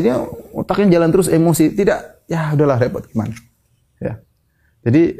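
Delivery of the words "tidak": usual speaking voice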